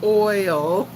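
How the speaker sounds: "oil" drawn out carefully; "oil" pronounced deliberately, not the old Texas way; learned pronunciation. Shown as a demonstration. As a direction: slowly show the learned way to say "oil". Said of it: "I've learned how to say oil".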